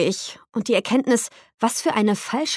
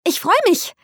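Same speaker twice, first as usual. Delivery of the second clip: much higher, gushing burst of joy; too eager "I'm looking forward to it" tacked on; overly enthusiastic; 11 semitones higher, 4 dB louder than usual. A narrator's reading. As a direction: overdo the delight, pitch it way up. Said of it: "viel zu enthusiastisches".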